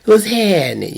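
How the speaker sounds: lightly mocking a too-cool greeting; phony flashy greeting. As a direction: ham up a showy pickup line. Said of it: "you ain't gotta do nothing grand".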